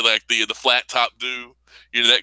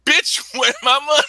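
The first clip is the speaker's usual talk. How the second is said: high, louder demand breaking into laughter; laughing mock demand; 12 semitones higher, 4 dB louder than usual. Luke Cage demanding money, laughing mock demand. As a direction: demand the money then crack up.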